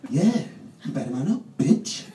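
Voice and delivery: loud mocking taunt; knife taunting. Knife bullying the lad, loud taunt. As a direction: sneer it out like a bully. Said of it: "I just hear this".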